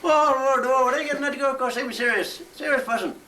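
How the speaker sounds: mock Belfast comedian; Frank Carson voice. Pretend guest on a chat show, mock Northern Irish comic.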